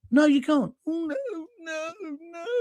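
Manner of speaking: high mock-fussy refusal fading to a soft wail; mocking, wimpish little voice; Keir Starmer. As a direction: pitch it up and let the noes trail away. Mocks Starmer refusing, fading away.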